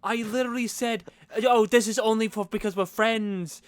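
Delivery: high-pitched mock pleading; hammed-up exasperated boyfriend; defensive Hardin impression. Voices Hardin making excuses.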